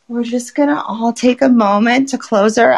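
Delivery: ordinary talk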